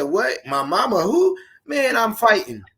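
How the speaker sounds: high-pitched, incredulous comeback; indignant challenge. Acted. Bullied kid reacting to a jab at mama, indignant challenge. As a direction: act out being ready to fight over an insult. Acting out reply to an insult.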